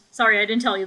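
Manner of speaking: ordinary talk, nothing marked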